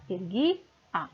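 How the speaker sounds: "Ergui-a" split, GUI stressed, the A apart; "Ergui-a" as erGUI, then a detached A. Shown as a demonstration. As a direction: lean on "gui", pause, then drop the "a". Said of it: "Vamos contar até o gui".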